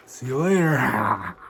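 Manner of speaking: a hint of a sinister showman intro; lightly voiced wrestler host. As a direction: greet them as a creepy host, slowly. Persona sign-off.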